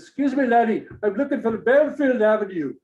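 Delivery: loud, high-pitched imitation Scottish brogue; heavy Scottish accent. Indian man asking driver for directions, heavy Scottish accent. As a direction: put on a thick Scottish accent. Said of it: "with this heavy Scottish accent".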